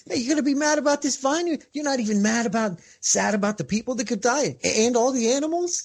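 teasing rebuke, rising pitch; God challenging Jonah. Retold or imitated. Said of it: "God challenging Jonah".

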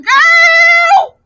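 put-on high, cracking shouted cry of girl; outraged shout. Shouting at the cheating husband, outraged cracking cry. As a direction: belt it out like scolding a cheater.

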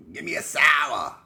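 loud, slow barked demand; mock tough Boston drinker; tough Boston guy.

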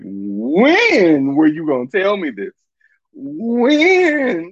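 slow, high, pleading demand; exasperated disbelief. Real, not acted. Asking the lover when he meant to tell her, high and drawn out. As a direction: draw out the question, baffled.

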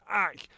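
a quick high "Ack!" like a punch to the gut; mock pained yelp. Charlie Brown jabbed by an umbrella, a mock pained yelp. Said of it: "He's gone".

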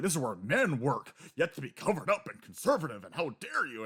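hammed-up old-school foreman lecturing; mock stuffy Paulie. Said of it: "And it's like".

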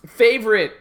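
loud announcer opening. Acted. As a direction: call out the topic like a host. Announcing the episode topic.

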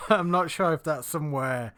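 talking through real laughter; laughing. Real, not acted. Friend doubts Barnsley Pleasure Beach is worth visiting, laughing.

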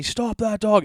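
high, mock yell; people yelling. Imitating strangers yelling at the owner to stop his dog. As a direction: shout it like a chasing stranger. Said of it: "people are yelling at me".

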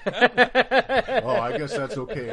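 high, loud, laughing through the words; mock him through laughter. Laughing at the opponent's backdown, high and loud.

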